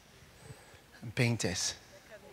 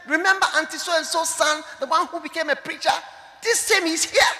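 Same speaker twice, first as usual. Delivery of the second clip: much higher, loud excited introduction; announcing relative; 9 semitones higher, 6 dB louder than usual. Retold or imitated. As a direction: announce him eagerly to the dying woman. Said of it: "he said that".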